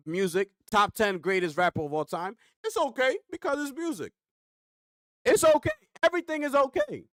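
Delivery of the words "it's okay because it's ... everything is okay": sarcastic exclaim. Real, not acted. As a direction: throw it up high, dripping with sarcasm. Repeated sarcastic list of okays.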